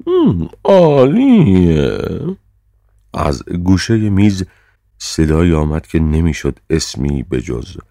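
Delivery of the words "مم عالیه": high, loud, drawn-out approval; chairman's long, high-pitched verdict; decided approval. A narrator's reading. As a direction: stretch it out high, as if the matter is settled. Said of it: "طوری که انگار تصمیم گرفته شده است".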